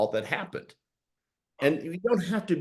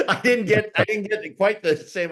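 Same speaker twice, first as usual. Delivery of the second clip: excited laugh; 8 semitones higher, 5 dB louder than usual. Real, not acted. Laughing admission about car repossession.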